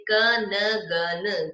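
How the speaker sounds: क न ग न sound by sound, slow; sounded out slowly. Shown as a demonstration. Teacher showing where न falls in कंगन, sounded out slowly. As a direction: break कंगन into क, न, ग, न. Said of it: "उसी के बाद में इसका उच्चारण".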